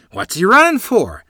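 high, loud puzzlement; puzzled question. A narrator's reading. Child asks the others about Clifford running, puzzled. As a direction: ask it baffled, voice jumping up. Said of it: "I asked in puzzlement".

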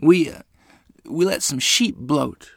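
tearful admission. A narrator's reading. Lottie answers mother's unasked question, mildly tearful. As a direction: falter through it, mildly upset. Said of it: "sobbing her eyes out".

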